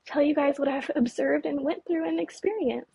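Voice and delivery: ordinary talk, nothing marked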